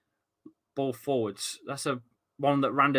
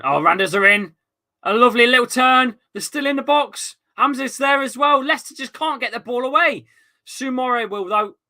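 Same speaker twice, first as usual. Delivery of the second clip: excited commentary; 8 semitones higher, 6 dB louder than usual. Real, not acted. Live match, sudden attack on goal.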